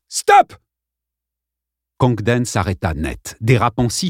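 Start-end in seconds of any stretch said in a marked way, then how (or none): 0.1-0.5 much higher and louder stop; boy yells at the bully to halt; shouted stop. A narrator's reading. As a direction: bark it out.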